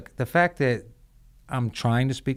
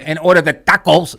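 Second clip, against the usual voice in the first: loud, higher, hammed-up Spanish on tacos; show-off gringo Spanish accent; 4 semitones higher, 8 dB louder than usual. Man mocking gringos who order food, exaggerated Spanish accent. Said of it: "that fucking guy who's like".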